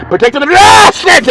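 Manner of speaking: quick, high, loud burst on ASSETS. Acted. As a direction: rattle it off and blast ASSETS. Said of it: "say it a little bit faster".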